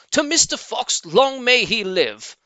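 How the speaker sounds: high, loud cheer; shouted toast. Acted. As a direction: raise the glass and roar it. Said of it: "they all shouted".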